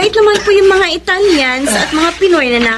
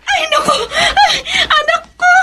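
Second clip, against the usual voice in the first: laughing and crying, half-shouting for joy; overjoyed mother, voice cracking with happy tears; tearful joyful welcome; 8 semitones higher than usual. Acted. Mother welcoming daughter home, laughing and crying.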